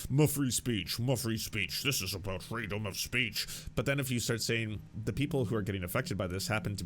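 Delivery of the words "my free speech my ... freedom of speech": lightly voiced whiny free speech complainer; mocking free speech crowd. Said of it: "coming at an angle about".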